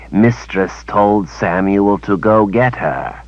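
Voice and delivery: slow, odd creature voice; slow dim-witted creature; simple creature servant. A narrator's reading. Creature servant explaining to his mistress, slow and odd.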